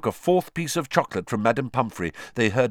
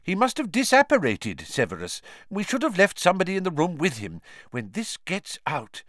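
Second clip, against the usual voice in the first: tag; 10 semitones higher than usual. A narrator's reading. Fudge frets over the escape.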